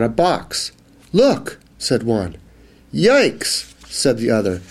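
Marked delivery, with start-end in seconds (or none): 1.1-1.6 excited squeak. Acted. Mouse calls out in story. 2.9-3.7 startled yikes. Acted. Mouse startled by the ice.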